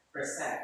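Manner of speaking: "Present" said softly, stressed on SENT; quiet demo of "Present" with weight on its second syllable. Shown as a demonstration. Teacher shows the syllable to emphasize, softly stressing SENT in "Present". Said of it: "emphasize on the second syllable".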